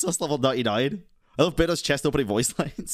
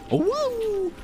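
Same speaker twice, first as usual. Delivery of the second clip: high-pitched wolf howl imitation; Razor's howl. Streamer copying a game character's howl, high howl. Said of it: "Razor's English howl".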